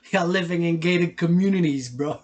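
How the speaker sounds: mock them with a laugh; loud laughing callout; taunting. Real, not acted.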